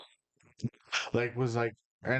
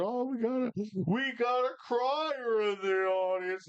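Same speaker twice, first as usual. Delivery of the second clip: mocking announcer; 12 semitones higher than usual. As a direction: tease the crying kid over the mic like a ringmaster. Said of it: "the announcer literally seen me and started making fun of me".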